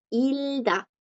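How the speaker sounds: "ilda" said with the G silent; teacher's drawn-out "ilda", no G; blocked G. Shown as a demonstration. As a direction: go from L straight to D in "ilda". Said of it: "Porque la consonante la bloquea".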